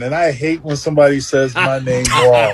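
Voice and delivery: ordinary talk, nothing marked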